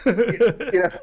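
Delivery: gasp, then high-pitched mock panic; acted panic. Actor doubting himself before the director's suggestions, mock-panicked. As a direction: replay a nervous inner freak-out, pitched high.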